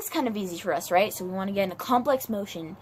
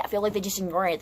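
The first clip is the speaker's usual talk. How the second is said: normal talking voice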